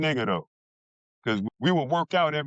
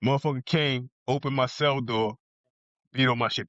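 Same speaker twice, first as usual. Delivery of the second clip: ordinary talk, nothing marked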